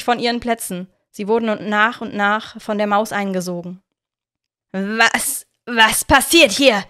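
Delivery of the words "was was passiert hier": loud, slow furious cry; angry shout. A narrator's reading. Victor shouting angrily as the mouse swallows everything. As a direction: bellow in rage and shock. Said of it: "schrie Victor wütend".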